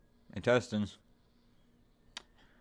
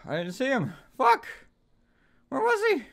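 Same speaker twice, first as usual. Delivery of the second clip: high frantic cry; panicked; 5 dB louder than usual. Real, not acted. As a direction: blurt it out rattled. Jumpscare panic.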